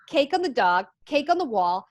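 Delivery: ordinary talk, nothing marked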